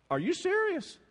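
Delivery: high disbelieving question; incredulous. Retold or imitated. Preacher recalling his reaction, a high incredulous question. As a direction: ask it like you can't believe it.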